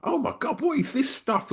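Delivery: very high-pitched, hushed astonishment; amazed gasping wonder. Retold or imitated. Recalling kids crowding round to see graffiti, amazed.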